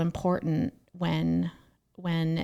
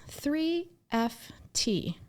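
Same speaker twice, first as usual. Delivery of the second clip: higher, slow, spelled-out letters; retold toddler reading; 7 semitones higher, 4 dB quieter, much slower than usual. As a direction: lightly voice a small child sounding out signs.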